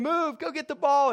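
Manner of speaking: mild retold yelling from the sidelines; imitated sideline yell. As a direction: imitate a soccer mom urging her kid on. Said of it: "she's yelling at him".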